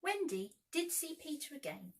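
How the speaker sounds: plain reading voice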